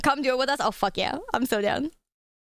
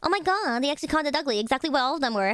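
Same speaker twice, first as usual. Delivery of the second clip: ordinary talk, nothing marked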